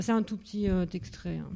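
ordinary talk

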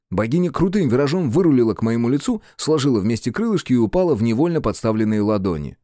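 ordinary delivery

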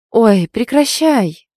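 loud, drawn-out protest; exasperated interruption. A narrator's reading. Heroine stopping her friend's mockery, exasperated. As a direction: cut the teasing off with exasperation. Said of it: "прервала я её издевательство".

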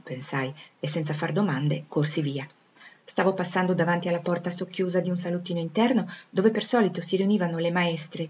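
ordinary delivery, nothing marked

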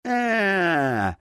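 drawn-out howl of pain. Acted. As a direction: cry out as a bone snaps. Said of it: "взвыл от боли".

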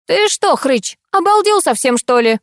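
high-pitched scolding outburst; indignant. A narrator's reading. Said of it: "Рассерженно уперев руки в бока".

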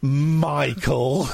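"Michael" stretched out slowly; teasing drawl. Pretend. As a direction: draw out "Michael" in mock significance. Host teases the caller's name.

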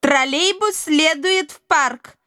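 slow, even metallic announcement; metallic announcer voice. Acted. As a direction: announce it like a flat machine. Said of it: "металлическим голосом объявила".

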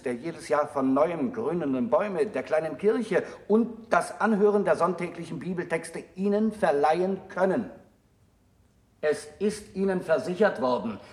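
usual speaking voice

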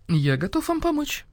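smooth gallantry; gallant offer. A narrator's reading. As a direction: offer help with chivalrous politeness. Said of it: "галантно предложил барсук-старший".

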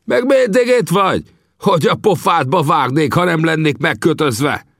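tied-up prisoner yelling at his visitor; furious yelling. A narrator's reading. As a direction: scream abuse at him. Enraged prisoner yelling insults.

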